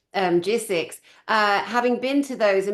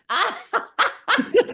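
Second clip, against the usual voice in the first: very high burst of laughter; high, squealing giggle; delighted laugh; 13 semitones higher than usual. Real, not acted. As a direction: crack up at your own joke. Laughs after accent joke.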